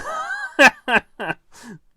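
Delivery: loud, high-pitched cackling laugh; real shrieking fit of laughter; helpless laugh. Real, not acted. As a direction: burst out laughing uncontrollably. Helpless laughing fit at own mistake.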